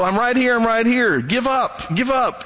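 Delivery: high, calm coaxing to a panicked swimmer; reassuring rescuer. Retold or imitated. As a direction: soothe someone out of their panic. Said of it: "You go".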